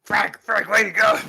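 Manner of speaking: high, loud pirate calling after Frank. Acted. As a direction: shout after him as he leaves.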